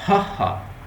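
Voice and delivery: "हा हा" as separate sounds from the throat. Shown as a demonstration. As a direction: push "हा हा" out from the throat. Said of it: "ये हमारे वो हलक से निकलती है". ("हा हा" हलक से निकालकर)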